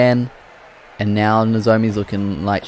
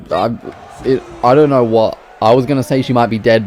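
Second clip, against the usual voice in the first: ordinary talk, nothing marked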